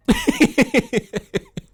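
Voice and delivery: high amused cackle; high laugh. Real, not acted. Vlogger laughing at the friend's answer, high cackle. Laughing at his own quote.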